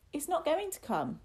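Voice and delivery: put-on high-pitched boyish protest; frightened denial. Boy denies the monster will come, high and defiant. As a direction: insist on it like a scared child.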